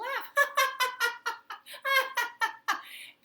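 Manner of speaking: long, high, loud laughter. Acted. Princess laughing at the stuck people, high laughter. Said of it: "she started to laugh".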